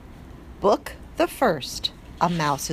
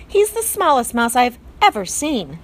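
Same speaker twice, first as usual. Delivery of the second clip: higher, louder disdainful aunt; Aunt Florence; 5 semitones higher, 4 dB louder than usual. A narrator's reading. Aunt looking down on the tiny mouse, disdainful. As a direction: sniff it disdainfully. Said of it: "said his Aunt Florence".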